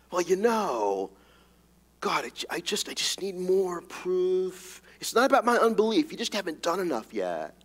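whiny mock excuse to God, hesitant then pleading. Preacher mimics a doubter stalling God with excuses. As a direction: play the stalling unbeliever making excuses.

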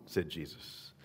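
ordinary talk

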